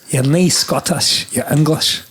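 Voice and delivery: slow Scottish "You're nae Scottish"; Scottish accent demo; Scottish phrase demo. Shown as a demonstration. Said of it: "can you repeat after me".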